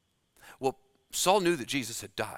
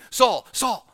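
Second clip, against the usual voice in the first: loud, high, urgent double call of the name; 9 semitones higher, 8 dB louder than usual. Shown as a demonstration. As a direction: call out Saul, Saul with urgency, raised pitch and volume. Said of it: "because something's urgent. You're talking quickly".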